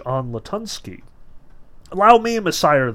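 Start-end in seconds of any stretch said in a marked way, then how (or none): 1.9-2.9 loud, raised-pitch eager offer; joyful cry. A narrator's reading. Cat volunteering to Messire, crying out joyfully. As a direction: cry it out, lightly voicing joy. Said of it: "the cat cried out joyfully".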